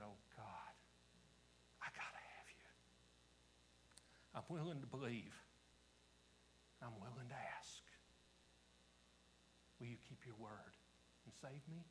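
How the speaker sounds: hushed, halting plea to God; quiet prayer. Retold or imitated. People with bowed heads asking God to save them, quiet prayer. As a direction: pray it softly, pausing between phrases. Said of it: "who just bowed their head".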